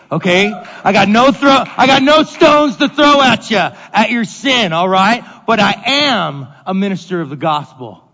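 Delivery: loud, driving preacher voice; fiery preaching. Acted. Pastor preaching forcefully to his congregation, loud and fervent. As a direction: press the point to the congregation.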